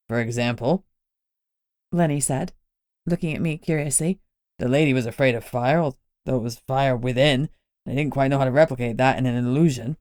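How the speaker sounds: put-on curious, easy-going old man explaining; a wily older man, unbothered. Lenny explains his illusion.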